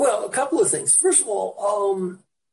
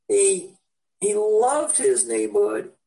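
normal talking voice

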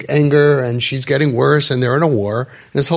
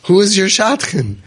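amazed question; 7 semitones higher, 3 dB louder than usual. Retold or imitated. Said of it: "I'm like".

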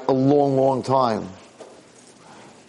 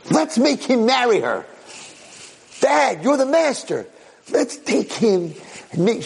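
high-pitched, eager pleading; eager scheming; 12 semitones higher, slower than usual. Acted. Jealous boy pitching his plot to father, eager scheming. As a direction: urge it high and excited.

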